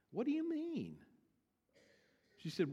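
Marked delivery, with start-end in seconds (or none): 0.1-1.0 put-on high, soft little-girl voice; puzzled child. Puzzled Diane questions her teacher, high and soft. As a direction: mimic a baffled child, pitched way up.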